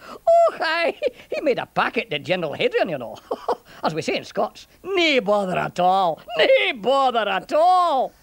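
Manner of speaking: laughing through a Scots burr; tickled old Scots soldier; chuckling Scottish centurion, gleeful. Acted. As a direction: relish the joke in a Scottish accent.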